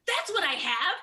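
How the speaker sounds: excited realization. Real, not acted. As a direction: it clicks: blurt it out with glee. Sudden ADHD realization.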